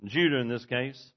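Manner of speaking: loud, high, driving sermon voice; full-force preaching. Acted. Preacher pressing his congregation, loud full-force preaching. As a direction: preach it hard to the back pews.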